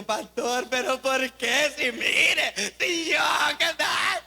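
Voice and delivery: queja fingida que sube de tono; payasada quejumbrosa. Acted. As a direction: imita burlonamente a un feligrés dramático que le llora al pastor. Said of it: "Deje esa payasada". (lamento exagerado, cada vez más agudo)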